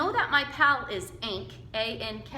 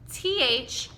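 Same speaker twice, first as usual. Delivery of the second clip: normal talking voice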